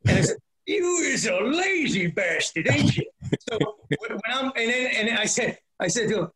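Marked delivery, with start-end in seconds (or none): 0.7-3.1 Bob the Cattle Rustler. Retold or imitated. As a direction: bark it at him like a loudmouth truck driver. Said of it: "big old badass Bob the Cattle Rustler".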